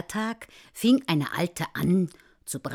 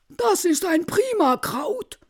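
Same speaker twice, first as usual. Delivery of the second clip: high, loud exclamation of approval; delighted guest; 12 semitones higher, 8 dB louder than usual. Acted. Guest who found the tobacco pouch, loud delighted praise. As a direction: crow over the find.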